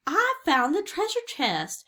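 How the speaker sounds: high, loud cry of discovery; excited cry. A narrator's reading. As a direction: call out in triumph, twice over. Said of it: "cried Jack".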